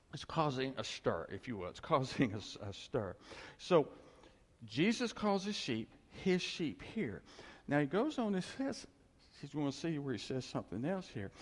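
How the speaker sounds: ordinary talk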